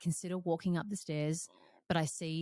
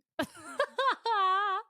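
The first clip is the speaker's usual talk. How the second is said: high loud laugh; 6 dB louder than usual. Real, not acted. Coach laughing at her own confession, high loud laugh.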